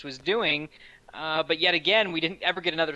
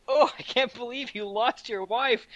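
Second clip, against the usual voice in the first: mock-dismayed wail, higher and drawn out; exaggerated, slow lament with raised pitch; mock heartbreak; 7 semitones higher than usual. Host mimicking his reaction to the film, mock heartbreak. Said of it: "make me just like".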